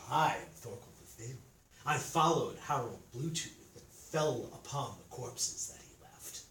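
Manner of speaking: lightly voiced demon, low and hushed; a hint of a low-voiced fiend; demon introducing itself. A narrator's reading.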